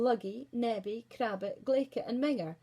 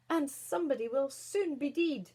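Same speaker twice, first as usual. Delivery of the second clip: roared; 5 semitones higher, 5 dB louder than usual. Acted. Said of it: "roared Minger".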